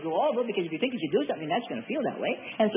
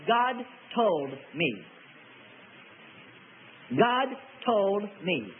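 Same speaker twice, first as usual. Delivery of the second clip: very slow, each word set apart; weighty pronouncement; much slower than usual. Shown as a demonstration. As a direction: weigh every word slowly. Warning against careless solemn claim.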